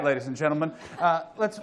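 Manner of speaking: ordinary delivery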